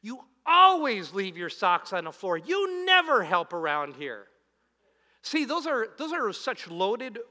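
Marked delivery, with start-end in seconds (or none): 0.0-4.3 loud, blaming complaint at the spouse; accusing nag. Shown as a demonstration. A spouse blaming the other over socks, loud and accusing. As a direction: throw it at them like an accusation. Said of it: "Avoid the words always and never".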